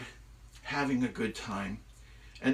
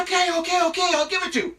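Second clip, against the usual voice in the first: high, loud, frantic giving-in; panicked surrender; 9 dB louder, much faster than usual. Acted. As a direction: blurt it out to save yourself. Loki gives in while falling.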